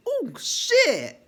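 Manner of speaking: sudden high, loud yelp of shock; high startled cry of shock; shocked outburst. Retold or imitated. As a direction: blurt it out in disbelief. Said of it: "I'm like".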